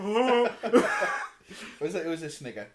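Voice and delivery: high, loud burst of laughter into the words; real giggle breaking up the line; laughing. Real, not acted. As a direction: laugh through the admission. Laughing at the canned-laughter joke.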